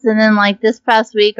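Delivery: ordinary talk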